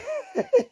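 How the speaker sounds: short high-pitched laugh. Real, not acted. Streamer amused at own remark to viewers, high laugh. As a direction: let out a brief high laugh.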